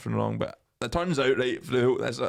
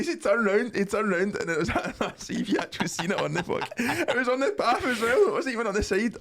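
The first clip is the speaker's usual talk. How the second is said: words squeezed out through real laughter, higher; laughing while talking; 10 semitones higher than usual. Real, not acted. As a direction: crack up as you say it. Cracking up at the memory.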